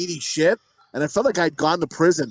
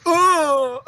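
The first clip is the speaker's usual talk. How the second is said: high, loud sudden exclamation; sharp high yelp; startled gasp; 9 semitones higher, 4 dB louder than usual. Pretend. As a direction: jump at the sight. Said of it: "fucking go".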